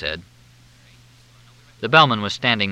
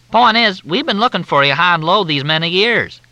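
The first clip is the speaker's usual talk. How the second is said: high, loud, syrupy Southern drawl; Southern officer on the phone, in a thick drawl; 10 semitones higher, 6 dB louder than usual. Acted. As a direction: drawl it out like a courteous Southern major. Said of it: "The drawl was thicker than Mississippi mud".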